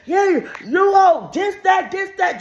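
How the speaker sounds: high, loud, slow jeering; hammed-up heckling chant; mock kids yelling. Kids hurling insults at her, shouting.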